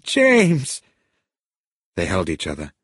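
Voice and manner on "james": high, loud cry of his name; urgent high-pitched call. A narrator's reading. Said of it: "she cried".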